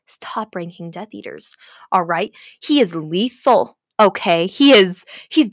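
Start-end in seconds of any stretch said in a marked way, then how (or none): 0.1-1.5 ominous hushed whisper. Real, not acted. Telling viewers about Draco, an ominous whisper. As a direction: breathe it like a dark secret.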